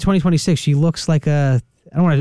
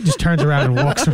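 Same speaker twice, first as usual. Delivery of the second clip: ordinary talk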